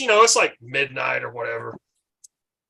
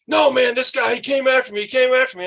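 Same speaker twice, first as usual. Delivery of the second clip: higher, louder protesting mock voice; Randy Savage; 8 semitones higher, 4 dB louder than usual. Randy Savage telling the cops the cook started it, higher and louder. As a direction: plead innocence to the cops, worked up. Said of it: "He's like".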